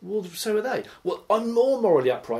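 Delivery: a self-righteous believer squeaking back; mock indignant believer. Acted argument over morals.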